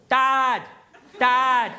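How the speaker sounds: DAD as one loud, level syllable; one-syllable call. Shown as a demonstration. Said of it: "it's just one syllable".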